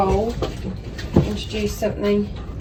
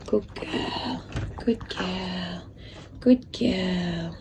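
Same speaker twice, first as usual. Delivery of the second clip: soft whisper to the puppy; whispered praise; 12 dB quieter, much slower than usual. Real, not acted. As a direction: hush it so she keeps eating.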